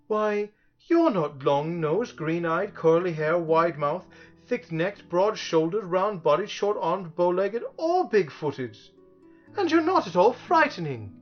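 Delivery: high-pitched indignant rebuttal. A narrator's reading. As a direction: list each point with rising indignation. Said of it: "said Milo indignantly".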